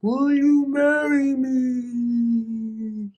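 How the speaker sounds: very slow, drawn-out puffy-lipped proposal; mock big-lipped proposal. Mocking Yara's underwater proposal, slow and drawn out. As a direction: stretch it out through swollen lips. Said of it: "Them big lips, just like".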